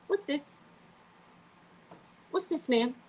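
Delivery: sharp, probing what's this; suspicious questioning. Retold or imitated. Questioning the pharmacy clerk.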